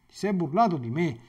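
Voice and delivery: ordinary talk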